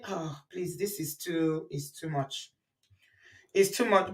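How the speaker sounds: hushed, low, weary plea; soft pleading. Retold or imitated. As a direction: whisper it like it's too much. Imitating the caller's complaint.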